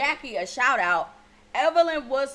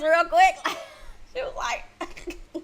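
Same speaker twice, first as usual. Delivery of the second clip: laughing; 5 semitones higher, much slower than usual. Real, not acted. Dying laughing at Jackie.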